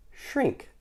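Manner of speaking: high, soft spell word; magic command. Acted. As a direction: cast it like a gentle spell. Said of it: "she said".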